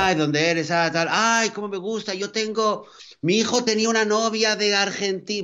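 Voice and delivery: high, gushing excited voice; gushing fan. Retold or imitated. Imitating people excited about Spanish.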